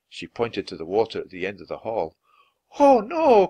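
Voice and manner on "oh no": high, loud frightened cry; alarmed cry. A narrator's reading. Lucy sees seawater flooding the hall, crying out. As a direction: cry out at the sight of the water. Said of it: "cried Lucy".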